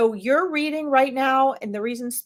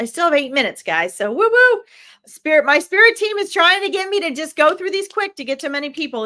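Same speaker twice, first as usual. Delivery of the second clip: higher and louder, hyped up; excited; 6 semitones higher, 6 dB louder than usual. Real, not acted. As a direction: pump the crowd up, racing the clock. Excited rush, running out of time.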